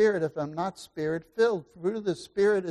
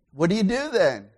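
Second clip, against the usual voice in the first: ordinary talk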